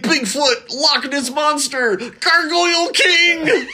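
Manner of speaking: very high, slow, rising wail of names; frantic crank. Retold or imitated. Dilton listing monsters in a high, slow, frantic wail. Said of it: "He's like".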